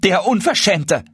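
high-pitched, outraged jab; indignant outcry. A narrator's reading. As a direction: denounce him to the neighbours, high-voiced. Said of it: "rief Hanne ihrer Nachbarin zu". (empört und hoch ausgerufen)

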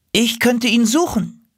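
high-pitched little offer; eager volunteer crow. Acted. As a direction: pipe up into the silence. Young crow volunteers into silence.

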